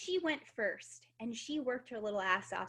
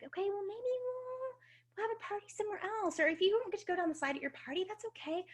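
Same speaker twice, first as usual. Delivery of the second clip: much higher, quick, sugary soothing voice; gently placating mom; 10 semitones higher, faster than usual. Retold or imitated. Mom easing her daughter's disappointment, higher and sweet. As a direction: soften the blow for a little girl. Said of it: "how can I diffuse it".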